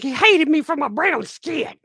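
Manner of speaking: high, loud mocking whine; mocking the witness. Streamer mocking the witness's claim about Johnny, in a high, loud mocking whine. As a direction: whine it out like a put-upon witness.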